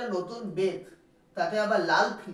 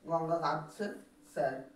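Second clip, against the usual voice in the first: ordinary talk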